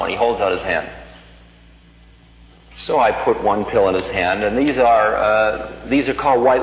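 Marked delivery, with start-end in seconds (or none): none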